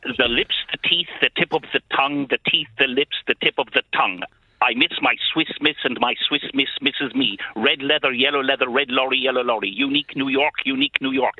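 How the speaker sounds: crisp, rhythmic articulation drill; carefully enunciated vocal warm-up; elocution exercise. Shown as a demonstration. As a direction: rattle off drama-school tongue twisters precisely. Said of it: "Say that again".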